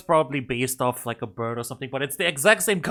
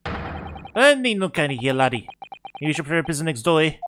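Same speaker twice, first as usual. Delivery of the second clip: hammed-up Scottish attempt; Scottish accent. Governor dismissing the visitor as laddie, rough Scottish accent. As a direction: put on a rough Scottish brogue. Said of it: "it's definitely Scottish".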